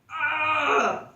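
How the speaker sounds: sudden high, loud hammed-up yell of strain; mock effort shout. Instructor straining at the student's arm, hammed-up loud yell. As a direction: blurt a big fake grunt of effort. Demo: trying to move partner's arm.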